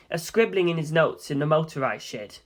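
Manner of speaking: ordinary delivery, nothing marked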